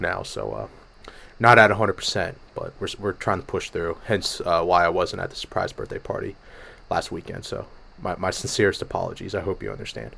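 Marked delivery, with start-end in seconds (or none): none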